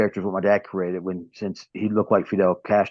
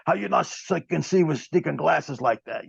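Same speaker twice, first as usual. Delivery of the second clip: high, put-on Cuban accent, mocking a fan; Cuban heel taunt; 8 semitones higher than usual. Wrestler mocks a fan's glasses in his Cuban character, accent. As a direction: sneer at the glasses like a Cuban villain. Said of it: "He pulled my".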